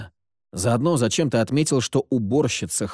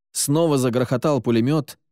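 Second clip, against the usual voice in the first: ordinary delivery, nothing marked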